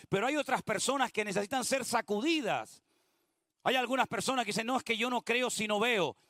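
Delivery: preacher warning the church, building to full force; fervent preaching. Acted. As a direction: exhort the congregation with rising fire.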